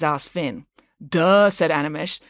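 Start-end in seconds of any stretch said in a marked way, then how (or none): 1.1-1.5 louder, higher drawn-out duh; scornful. A narrator's reading. Animesh answers Finn's stupid question, scornful. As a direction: make the answer sound painfully obvious. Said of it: "as if Finn's question was too stupid".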